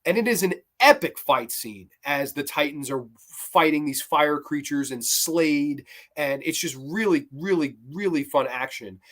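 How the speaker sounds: ordinary talk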